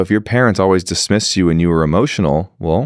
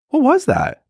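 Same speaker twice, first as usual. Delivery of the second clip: very high, curious what was that; bright curious question; curious surprise. Retold or imitated. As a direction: invite her to open up. Curious after partner cries.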